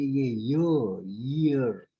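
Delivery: ईयर said slowly and clearly, as in year; correct pronunciation. Shown as a demonstration. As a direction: stretch out ईयर like the English word year. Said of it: "इसका सही उच्चारण है ईयर". (सही उच्चारण में धीरे से ईयर)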